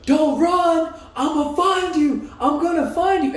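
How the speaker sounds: mock menacing yell, high and drawn out; retold screaming. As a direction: imitate a pursuer yelling out threats. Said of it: "he's screaming as he's like".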